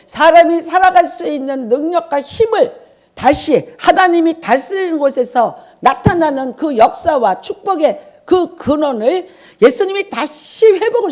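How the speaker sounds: rising pulpit fervor; fervent preaching. Real, not acted. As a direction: press the blessing home with fire. Preaching climax, higher and louder.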